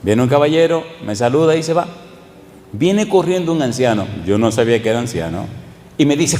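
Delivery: ordinary talk, nothing marked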